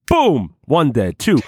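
ordinary talk, nothing marked

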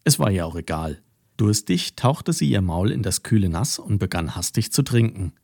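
plain reading voice

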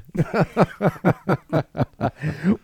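rising high-pitched laugh. Real, not acted. Laughs at own ad line.

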